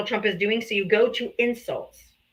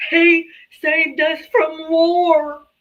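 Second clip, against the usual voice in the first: slow, higher sneering parody; mocking voter; 5 semitones higher, 4 dB louder, much slower than usual. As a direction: stretch out the slogan with contempt. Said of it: "I'm like".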